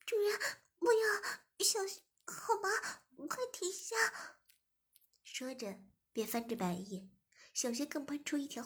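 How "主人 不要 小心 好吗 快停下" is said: crying plea. Acted. Zhimin begs the master to stop, high crying plea. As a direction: beg through tears, high. 志明 cries out under shock.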